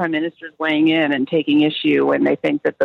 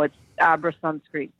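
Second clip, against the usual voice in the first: ordinary talk, nothing marked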